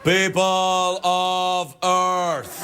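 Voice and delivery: very slow, sustained booming address; grand alien proclamation. Acted. Monkey king addresses all of Earth, grand drawn-out proclamation. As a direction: stretch each word into a chanted proclamation.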